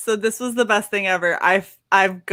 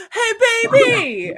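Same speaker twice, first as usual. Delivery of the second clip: mock catcaller; 12 semitones higher, slower than usual. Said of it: "he goes".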